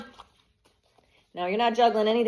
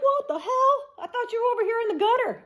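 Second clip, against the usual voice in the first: much higher incredulous squeal; be stunned they rose so fast; shocked doubters; 14 semitones higher than usual. Retold or imitated. Voicing doubters stunned by the comeback, high disbelief. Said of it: "And they're like".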